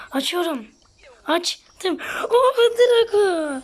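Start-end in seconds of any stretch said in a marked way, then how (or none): 2.3-3.6 slow high delighted cry with a laugh; excited. Real, not acted. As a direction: let it burst out with joy. Unlocks new legendary character.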